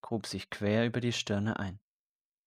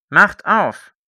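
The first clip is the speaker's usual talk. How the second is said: shout; 9 semitones higher, 14 dB louder than usual. A narrator's reading. Soldier pounding at the door.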